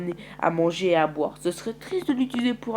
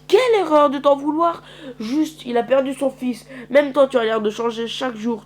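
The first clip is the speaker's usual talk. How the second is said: angry plea; 7 semitones higher, 7 dB louder than usual. Acted. Mara confronts the leader.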